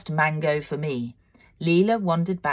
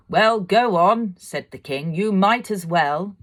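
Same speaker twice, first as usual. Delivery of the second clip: louder, slow, higher royal urging; a jovial king nudging them on; king coaxing the couple, hearty; 5 semitones higher, 6 dB louder than usual. A narrator's reading.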